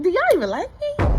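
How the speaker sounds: high, disbelieving squeak of a question; high-pitched mock disbelief; incredulous. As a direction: act stunned that your friends gave such advice. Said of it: "I'm like".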